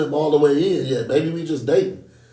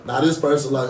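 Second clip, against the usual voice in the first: ordinary talk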